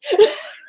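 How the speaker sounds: high, helpless laughing burst; burst of laughter. Real, not acted. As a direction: crack up at your own joke. Laughing at her own impression.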